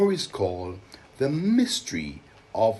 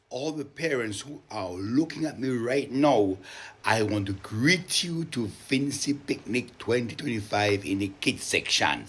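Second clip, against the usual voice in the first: ordinary delivery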